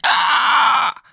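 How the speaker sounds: high, loud mock scream of pain; an alien-sounding shriek. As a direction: yelp like you've just been bitten. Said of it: "And he's like".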